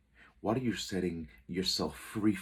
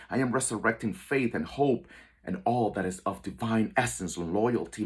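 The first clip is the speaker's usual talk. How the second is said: raised, forceful affirmations in a steady chain; declaration of faith; 3 semitones higher, 5 dB louder than usual. Shown as a demonstration. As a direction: proclaim each one with power and conviction. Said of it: "say it with inner faith".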